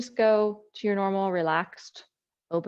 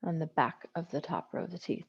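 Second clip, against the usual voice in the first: quiet repeat; 2 semitones lower, 9 dB quieter than usual. Real, not acted. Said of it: "I said that the way I wanted to".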